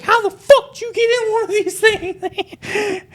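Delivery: high, slow mock outraged mom. Girlfriend's mom finds them in the cabana, high and slow. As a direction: shriek it as a scandalized mom. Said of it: "Her mom's like".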